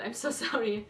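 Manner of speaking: ordinary talk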